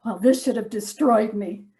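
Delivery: normal talking voice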